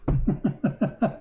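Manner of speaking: short real chuckle; a run of quick chuckles; laugh. Real, not acted. Amused at the verse he reads.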